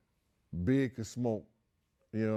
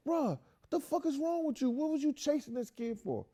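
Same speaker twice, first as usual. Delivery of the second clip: much higher, exasperated disbelief; high, disbelieving retold rebuke; incredulous scolding. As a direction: demand an answer like he's lost his mind.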